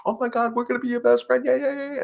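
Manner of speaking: very high, squealing mock excitement; gushing excitement. Trainer mocking people rushing a scared dog, squealing high. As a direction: overdo the thrilled greeting.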